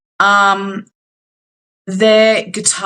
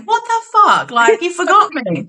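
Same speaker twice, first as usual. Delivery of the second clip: much higher, hammed-up indignant band mate; mock outrage; 10 semitones higher than usual. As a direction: play the wounded friend who got skipped, pitched way up. Dylan left out of introductions.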